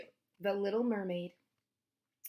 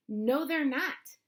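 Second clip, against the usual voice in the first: slow, higher mocking contradiction; mild snickering taunt; 5 semitones higher, 4 dB louder, much slower than usual. A narrator's reading. Bully contradicting girl about mermaids, slow and taunting. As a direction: drag it out to tease. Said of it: "still snickering".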